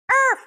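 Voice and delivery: high loud yap; sharp imitated yap; dog bark.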